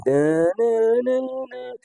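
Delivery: sung-out beat that climbs high, mimicking the sample; beat imitation. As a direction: hum the sample's riff, sliding way up at the end.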